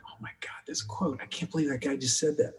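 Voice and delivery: breathless whispered awe while the camera rolls; stage-whispered excitement at a great quote; hushed whisper. Retold or imitated. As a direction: whisper it so the mic won't catch you. Quiet reaction while filming.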